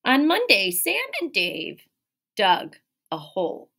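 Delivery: lively, expressive read-aloud; animated picture-book reading; reading to children. Acted. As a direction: read it like storytime for little kids. Teacher reads picture book aloud.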